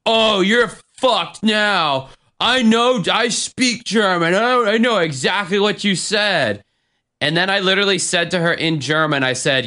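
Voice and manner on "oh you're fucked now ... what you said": high, drawn-out mock drunk boasting; Kimmy. Drunk woman bragging she speaks German, mocking imitation. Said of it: "She goes".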